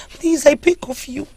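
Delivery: high, breaking plea; desperate, high begging; tearful pleading. Acted. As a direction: beg through rising tears. Princess begs for her husband.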